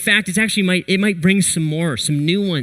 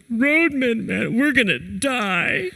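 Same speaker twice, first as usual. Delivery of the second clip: high, slowed, tearful plea that they will die; imitated crying; 7 semitones higher, slower than usual. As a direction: play the buddy breaking down in fear on the summit. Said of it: "and he starts crying".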